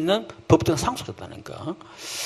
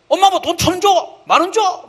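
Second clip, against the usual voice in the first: put-on high, whiny kid demanding money; pushy child; 12 semitones higher than usual. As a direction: play a spoiled kid nagging mom. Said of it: "child asking mom for money". (엄마한테 돈 조르는 뻔뻔한 아이 목소리)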